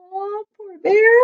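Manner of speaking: ordinary delivery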